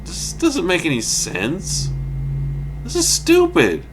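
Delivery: high-pitched retold disbelief; baffled exasperation. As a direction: squeak out the bewilderment, rising to indignation. Reliving his baffled reaction.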